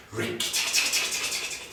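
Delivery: fast chattering whispered war cry; mongoose war cry. A narrator's reading. Mongoose scuttling through grass, chattering its war cry. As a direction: rattle it off like a tiny animal's battle chatter. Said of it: "his war cry".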